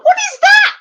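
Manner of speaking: high, loud laughing shriek of surprise; squealed "what is that" in laughter; laughing disbelief. Real, not acted. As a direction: shriek at the broken heart through a laugh. Squashed heart emoji in chat.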